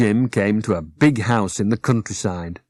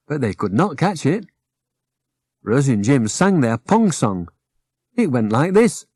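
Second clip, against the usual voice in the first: ordinary delivery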